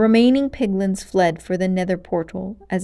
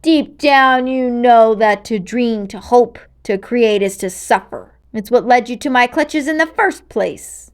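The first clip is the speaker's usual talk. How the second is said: menacing witch voice; 3 semitones higher, 7 dB louder than usual. Acted. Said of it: "she managed to growl".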